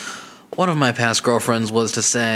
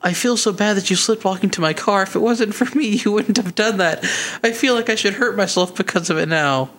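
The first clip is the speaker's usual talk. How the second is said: higher, mock-sad imitation of the ex; the ex on the phone, mock-tearful and higher; imitating ex; 9 semitones higher than usual. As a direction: voice the ex as a tearful little victim, higher. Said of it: "until she replies".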